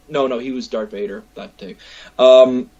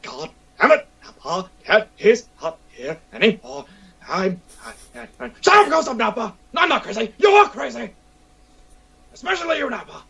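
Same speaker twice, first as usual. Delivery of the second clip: higher, ranting Vegeta voice; angry Vegeta; 8 semitones higher than usual. Acted. Cosplayer in character snaps at fans calling for Nappa, angry rant. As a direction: rant like an annoyed Saiyan prince. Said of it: "And I'd be like".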